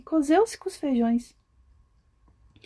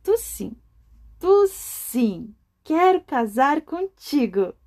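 a light happy cry of yes; a hint of a smitten little beetle; eager carrochinha; 3 semitones higher, slower than usual. A narrator's reading. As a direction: choose him with delight. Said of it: "disse a carrochinha".

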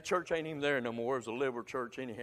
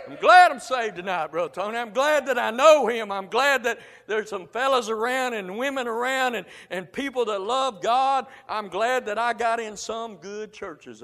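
loud, higher preaching in full cry; a preacher hollering thanks, louder and higher; joyful shouting; 7 semitones higher, 10 dB louder than usual. Real, not acted. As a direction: shout your gladness to the congregation. Joyful shouting after the whoop.

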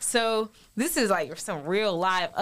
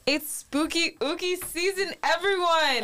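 high, sing-song spooky voice; spooky announcer voice; 8 semitones higher than usual. Pretend.

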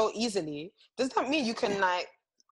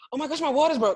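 hammed-up TV panic, higher; mock a soap-opera birth; TV mom drama; 6 semitones higher than usual. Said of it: "The woman goes".

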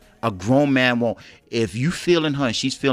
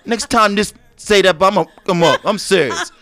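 mock outrage; 7 semitones higher, 6 dB louder than usual. Comic rant at being called lucky.

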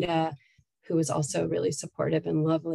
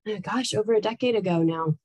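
ordinary talk, nothing marked

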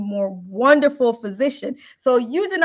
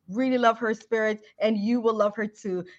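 usual speaking voice

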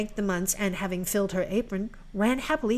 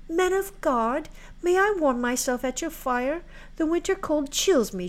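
put-on pleading girl voice; 7 semitones higher than usual. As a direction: ask shyly, shivering girl. Cold girl begs shelter.

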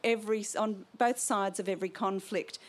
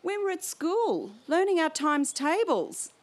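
lightly voiced small boy, pitched higher; little boy's protest; 7 semitones higher, 3 dB louder than usual. Otto protesting to Liesl, a lightly voiced young boy. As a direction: give Otto a higher, childlike plea. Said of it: "he whispers".

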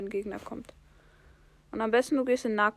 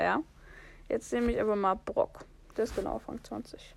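ordinary talk, nothing marked

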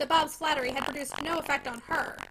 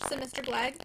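ordinary delivery, nothing marked

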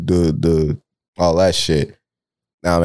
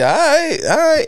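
high mock all right. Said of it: "mock agreement".

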